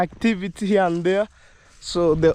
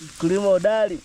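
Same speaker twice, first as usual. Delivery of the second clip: ordinary talk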